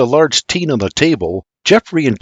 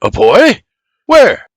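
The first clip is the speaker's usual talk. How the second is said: startled question; 8 semitones higher, 6 dB louder, slower than usual. A narrator's reading. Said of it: "Doc asks".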